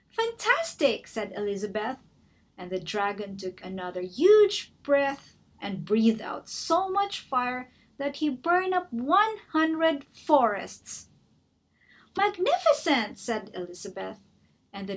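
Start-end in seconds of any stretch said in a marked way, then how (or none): 0.1-1.1 high, gushing praise; excited praise. Acted. Elizabeth praising the dragon, gushing. 12.2-13.2 high, gushing praise; excited praise. Acted. Elizabeth praising the dragon, gushing.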